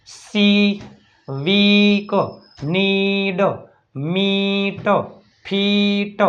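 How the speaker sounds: each ee drawn out, as in "સી"; long ee. Shown as a demonstration. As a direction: hold the vowel long and level in "નીડ". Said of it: "દીર્ઘાય આવશે બધાયમાં".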